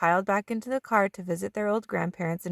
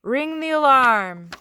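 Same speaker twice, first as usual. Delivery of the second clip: slow, loud, drawn-out play call; dramatic play call; 6 semitones higher, 7 dB louder, slower than usual. A narrator's reading. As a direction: announce the trick play with flair. Peyton calls a play.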